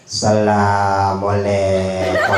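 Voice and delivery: very slow, low droning greeting; mock donation-seeker's long-drawn call; most peculiar salam. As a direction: drone each syllable out on one low note. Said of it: "ini salamnya paling unik begini".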